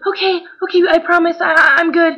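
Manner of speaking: high-pitched imitation of an eager girl; eager promising. Grateful friend promising to behave.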